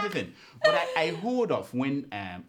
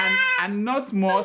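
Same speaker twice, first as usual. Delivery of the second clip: laughing while talking; 9 semitones higher, 7 dB louder, slower than usual. Real, not acted. Laughing through the words.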